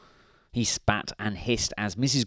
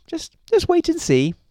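very high, loud wifely tease; insistent high-pitched storybook wife; Missus Grinling; 6 dB louder than usual. A narrator's reading. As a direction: hold back the secret in falsetto. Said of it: "said Missus Grinling".

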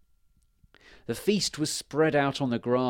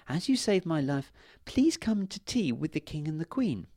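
fairy voice; 6 semitones higher than usual. Acted.

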